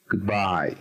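"goodbye" with "bye" stressed; short "good", long stressed "bye" in "goodbye"; stress on second syllable. Shown as a demonstration. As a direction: lean on "bye" in "goodbye", letting it fall. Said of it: "en la segunda sílaba".

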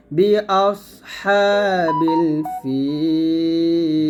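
বি অস হা বিল ফিল slow, with a heavy সদ; tajweed recitation. Shown as a demonstration. Teacher recites the phrase slowly for students. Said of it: "সদ উচ্চারণটা মোটা করবেন".

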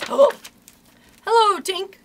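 high, loud surprised hello; wondering cry. A narrator's reading. Wondering boys greeting Tink, excited cry. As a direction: hail her in high-pitched wonder. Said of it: "cried the wondering boys".

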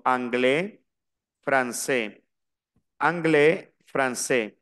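anglais and français ending in an open E; silent final S. Shown as a demonstration. Teacher models the rule for students, anglais, français with silent S. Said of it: "la S no la pronuncio".

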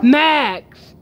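sharp loud yell for the dog, pitch jumping up; calling the dog. Real, not acted. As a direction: shout the dog's name to make him come back.

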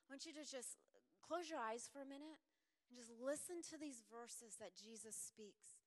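usual speaking voice

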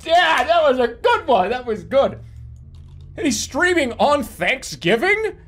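loud, high mock praise; sarcastic relatives. Relatives at dinner mocking the stream, loud sarcastic praise. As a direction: say it like a relative rolling their eyes. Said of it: "everybody at the dinner table is like".

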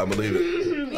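I believe it squeaked very high; high squeal. Real, not acted. Repeated higher.

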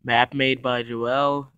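loud, slow announcement; announcer credits. Acted. Reading map credits.

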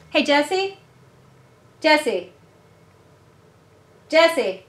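loud, high call to another room. Real, not acted. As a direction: holler for someone out of sight. Calling someone in another room.

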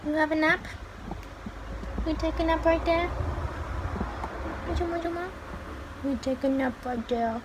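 sing-song coo to a napping pet; pet praise. Real, not acted. Praising pet while it naps.

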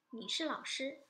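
normal talking voice

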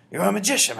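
high, loud delighted praise; thrilled high exclamation; shouted. A narrator's reading. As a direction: shout it in admiration. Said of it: "my grandmother shouted".

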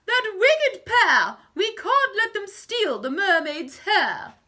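loud, high cry of protest; indignant high-voiced proclamation; outraged declaration. A narrator's reading. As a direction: declare it loudly, pitched well up. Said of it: "the fish declared".

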